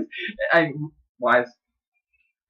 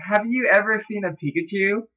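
put-on girl's voice; 7 semitones higher than usual. Shown as a demonstration. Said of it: "I'm gonna do this in a girl's voice".

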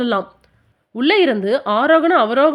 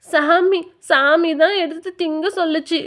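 put-on crying child; 5 semitones higher than usual. A narrator's reading. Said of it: "என்று அழுதான்".

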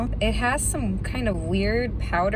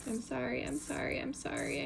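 hushed, higher, quieter repeated apology to the spider; soft apology; 5 semitones higher, 11 dB quieter than usual. Real, not acted. Apologising to the tarantula while touching it, hushed and softer. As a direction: murmur sorry gently, much softer.

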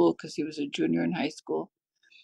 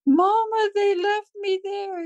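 high, drawn-out wail to mama; plaintive high child voice, acted out; imitated crying child; 12 semitones higher, slower than usual. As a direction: retell a little boy's tearful cry, high and slow. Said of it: "he was just crying".

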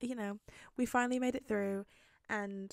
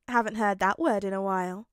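louder, playful dig; teasing; 9 dB louder than usual. A narrator's reading. Midoriya ribbing Bakugo about saying thanks, teasing. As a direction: needle him playfully at raised volume. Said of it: "Midoriya teased".

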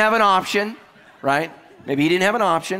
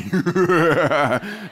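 drawn-out mock villain cackle; evil laugh. Husband spooking wife at bedtime, mock evil laugh. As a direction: laugh like a TV murderer.

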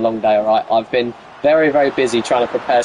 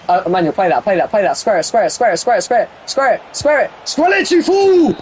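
building plea; 6 semitones higher than usual. Real, not acted. Streamer begging Emmanuel to square it, climbing to a shout. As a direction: get more desperate with every repeat until you snap.

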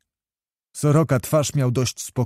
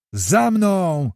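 drawn-out, higher-pitched call; ringing call; 5 semitones higher than usual. A narrator's reading. Kmicic leads the troop into darkness, calling out. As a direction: let the order carry through the dark. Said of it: "rozległ się głos Kmicica".

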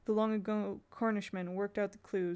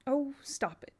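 sharp self-scolding; 6 semitones higher, 3 dB louder, slower than usual. Acted. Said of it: "Jane said aloud".

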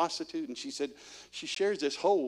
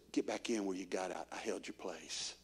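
tender voice of the Lord; 8 semitones lower, 12 dB quieter than usual. Retold or imitated. Preacher voicing the Lord welcoming him back, tender. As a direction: say it gently, like holding a door.

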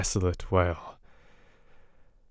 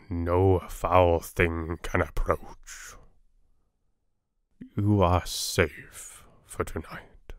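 wonderful calmness; 3 semitones lower, slower than usual. A narrator's reading. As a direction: soothe them, slowly and gently. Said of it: "said with wonderful calmness".

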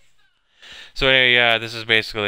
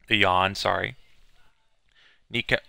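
ordinary talk, nothing marked

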